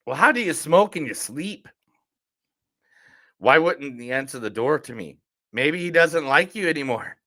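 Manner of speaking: film quotes in a put-on Irish accent; Irish lilt, acted out. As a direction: play it as an Irish character. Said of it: "Thank you for doing the Irish accent".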